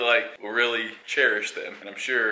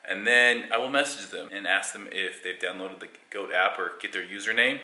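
ordinary delivery

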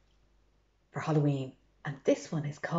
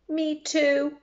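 small creature voice; 8 semitones higher than usual. Acted. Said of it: "says a butterfly".

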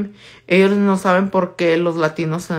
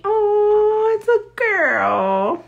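high slow cooing oh; high, slow cooing surprise; much slower than usual. Pretend. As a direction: coo it slowly, pleasantly surprised. Cooing at viewer's dog.

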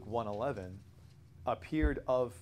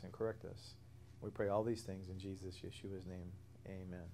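prayer; 2 semitones lower, 5 dB quieter than usual. Acted. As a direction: pray it gently and quietly. Closing prayer for the class.

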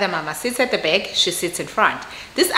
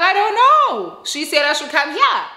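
exasperated protest; 8 semitones higher, 3 dB louder than usual. Retold or imitated. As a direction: re-enact helpless exasperation way up high. Acting out defensive reply to husband.

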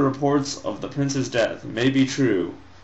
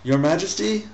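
squeaking; 8 semitones higher than usual. Acted. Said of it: "Ahmed squeaked".

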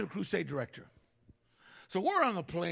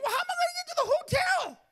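very high, louder, crying complaint; outraged whine; 5 dB louder than usual. Retold or imitated. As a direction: wail it like a stranded kid. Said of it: "I didn't say it like that".

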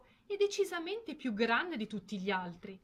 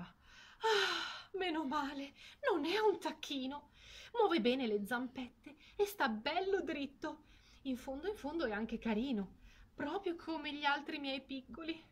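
relieved mother duck; 6 semitones higher than usual. Acted.

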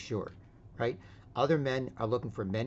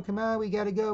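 higher, insistent plea; imitated nagging mom; 10 semitones higher, 4 dB louder than usual. Mom urges her boy out the door, mock nagging. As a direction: voice a mom pressing her whining child. Mother urging child to leave.

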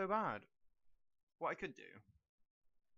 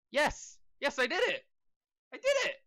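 rising, louder victory shout; triumphant cheer; 10 semitones higher, 5 dB louder than usual. Real, not acted. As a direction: cheer like you just won. Winning the level.

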